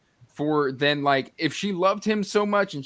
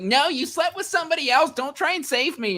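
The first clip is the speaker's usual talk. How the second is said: high-pitched mock tantrum; petulant child; 14 semitones higher than usual. Mimicking the elf shoving him away, petulant child. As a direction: whine it like a sulky kid, much higher. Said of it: "like a petulant child".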